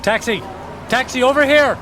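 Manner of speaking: high, loud shout to a cab; hailing a taxi. Acted. Man in a windy street hailing a taxi, shouting high. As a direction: flag the driver down over the wind.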